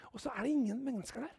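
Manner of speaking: fast, whispered hush. Acted. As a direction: drop to a breath for the empty scene. Dramatic hush after stones. (hvisket fort og stille)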